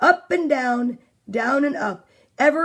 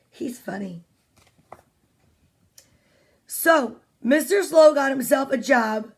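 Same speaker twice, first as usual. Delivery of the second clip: ordinary delivery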